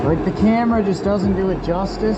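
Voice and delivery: amazed, high and stoked; excited. Real, not acted. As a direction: savour the result, thrilled. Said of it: "I am so stoked".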